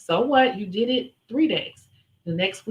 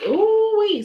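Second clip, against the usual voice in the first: ooh-wee; 12 semitones higher, 5 dB louder than usual. Real, not acted. Exclaiming at running into people.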